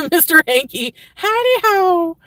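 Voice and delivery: sing-song cartoon hello; Mr. Hankey's cheery squeal. Greets like the cartoon poo.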